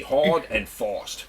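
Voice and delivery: "hard and fast" slow, "fast" as "forst"; South African vowels. Shown as a demonstration. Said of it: "You've got to go F O R S T".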